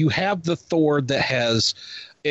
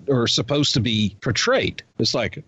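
ordinary talk

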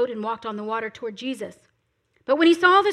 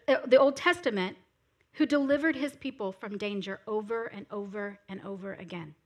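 usual speaking voice

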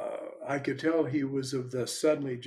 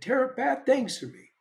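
put-on upset child; 8 semitones higher than usual. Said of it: "and he said".

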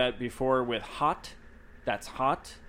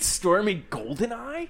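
mock posh name; 8 semitones higher, 3 dB louder, slower than usual. Mocking the baby name.

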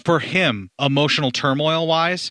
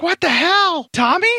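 startled; 3 dB louder, slower than usual. Retold or imitated. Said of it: "And then she's like".

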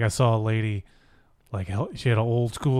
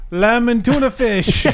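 high, slow silly character voice; silly impression; 10 semitones higher, 5 dB louder, slower than usual. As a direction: draw out the line in a high put-on voice. Doing celebrity impression bit.

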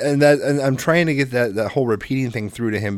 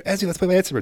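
put-on higher pleading kid voice; little boy; 8 semitones higher, faster than usual. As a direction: beg like a small child. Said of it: "Trent grabs a lightsaber".

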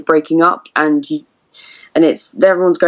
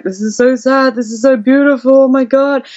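higher, tearful gush over a sad scene; overwhelmed, a little choked; imitating tearful self; 7 semitones higher than usual. Recalling crying at the cinema, gushing tearfully. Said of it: "I just kept crying 'cause I was like".

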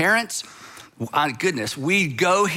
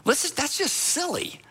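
dismissive outsider; 5 semitones higher than usual. Retold or imitated. Outsider advising estranged parents to just call, dismissive and impatient. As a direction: brush it off as obviously simple. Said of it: "you think to yourself".

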